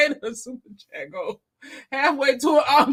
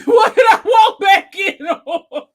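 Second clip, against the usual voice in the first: loud, slow, laughing disbelief; a chat comment read out as a laughing shout; laughing exclamation; 7 semitones higher, 10 dB louder than usual. Retold or imitated. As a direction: shout it through a laugh as if walking in on chaos. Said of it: "Raquel said".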